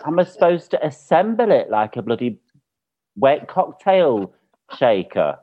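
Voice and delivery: high, exasperated outrage; worked-up comic indignation; retold angry rant. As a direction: rant in disbelief, dragging out key words. And I was just angry.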